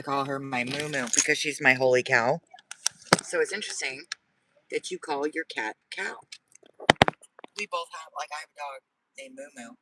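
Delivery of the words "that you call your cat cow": ordinary delivery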